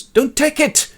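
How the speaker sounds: high, loud warning cry; urgent shout. Acted. John warns workers lining up for soma, urgent shout. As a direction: shout it to stop a crowd.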